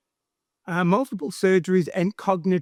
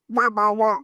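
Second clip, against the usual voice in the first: duck voice; 3 semitones higher than usual. Acted. Ducks flying over in joke.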